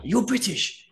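high, pointed mock accusation; Gaston accusing. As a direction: fire it at him like a charge. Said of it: "Gaston ran up to me and was like".

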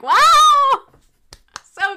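mock cheering from an awestruck crowd; hammed-up loud wonder; amazed crowd. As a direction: gush like an impressed onlooker. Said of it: "everybody's like".

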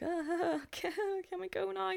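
awkward plea. Retold or imitated. Acting out awkward reply to date.

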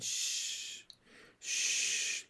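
soft whispered shushing; a whisper to calm a sobbing boy; soothing hush. A narrator's reading. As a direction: hush him gently like a small child. Father soothing crying son.

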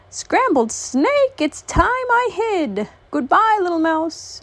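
high, slow, alarmed snake; scared snake. Acted. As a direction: gasp it out and slink off in fright. Frightened snake hides.

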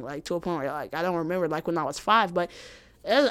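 ordinary talk, nothing marked

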